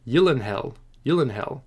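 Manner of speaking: "Yillenhell" with Y onset and "hell" ending; correct pronunciation. Shown as a demonstration. Host practising a surname he never knew, correct pronunciation. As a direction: try out "Yillenhell", Y in place of G. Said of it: "is actually correctly pronounced".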